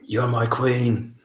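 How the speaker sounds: slow, drawn-out English accent attempt; amateur impression of an English TV hero; Jon Snow. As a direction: draw it out in a put-on English accent. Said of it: "trying to talk in an English accent".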